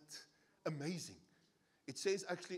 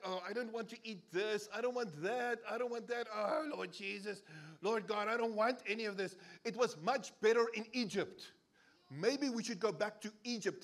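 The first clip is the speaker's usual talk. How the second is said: higher, whining Israelites who want more; whiny complaining; 6 semitones higher, 5 dB louder than usual. Retold or imitated. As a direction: moan like a spoiled ingrate. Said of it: "They're like".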